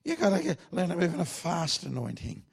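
put-on high-pitched old Australian cowboy pastor; Clark Taylor. Old pastor coaching his trainee to be quick, mimicked high. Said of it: "he talks like this".

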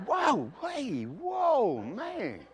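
mock taken aback. Newcomer reacting to shouting counterman, mock startled. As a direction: back off from the yelling in comic shock.

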